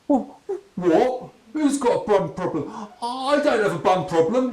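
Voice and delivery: a panicked man denying it all; squeaky outraged banker; gurgled denial. Acted. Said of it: "he gurgled".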